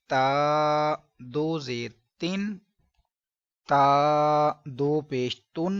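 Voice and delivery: slow, drawn-out spelling drill; careful drill recitation. Shown as a demonstration. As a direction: sound out each syllable slowly for students. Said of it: "ध्यान से सुनिए कि इसका, इसको पढ़ने का दुरुस्त तरीका क्या है".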